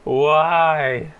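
ordinary talk

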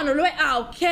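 ordinary delivery

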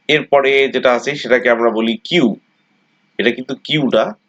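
ordinary talk, nothing marked

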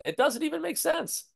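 high-pitched, exasperated disbelief; incredulous. Real, not acted. Baffled objection to the article's wording.

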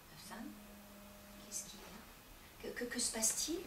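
husband whispering anxiously to his wife. Acted.

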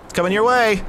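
high mock warning shout; taunting shout. Gamer warning the enemy of the incoming bomb, gleeful shout.

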